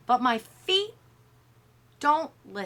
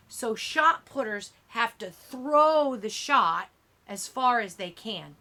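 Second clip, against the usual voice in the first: ordinary delivery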